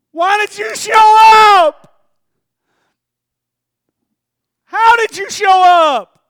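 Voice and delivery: high, loud, slow sobbing cry; crying shout. Acted. Wounded man crying to his rescuer, loud and tearful. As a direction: wail it out in tears.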